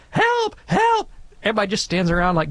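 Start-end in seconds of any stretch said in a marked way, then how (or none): 0.1-1.1 high-pitched mock cry for help; mock scream. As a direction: imitate a panicked scream without real volume. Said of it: "if you just scream".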